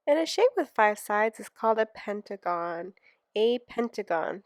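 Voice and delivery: "pentagon" said loud and proud; emphatic reveal. Shown as a demonstration. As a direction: announce "pentagon" with a big emphatic voice. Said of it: "announcing the new shape name".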